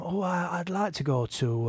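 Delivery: put-on soft, quiet Yorkshire accent. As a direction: imitate the wife in a Yorkshire accent. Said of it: "which is why I'm doing a Yorkshire accent".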